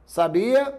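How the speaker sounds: sabia, stressed on the BI; stress on middle syllable. Shown as a demonstration. Teacher contrasting same letters for students, stress on middle syllable. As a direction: lean on the i of sabia, sa-BI-a. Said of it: "só vai saber se eu pronunciar".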